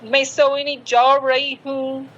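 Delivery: mock English accent on Hindi; anglicised Hindi, as what to avoid; accented Hindi demo. Shown as a demonstration. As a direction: put a foreign English accent onto Hindi. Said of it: "Hindi में accent मत लाइएगा".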